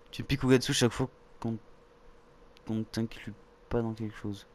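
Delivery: ordinary delivery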